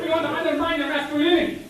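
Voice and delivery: loud, high hyped-up yelling; hyped shout. Acted. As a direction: pump up the team, shouting. Teammate overhyped pep talk.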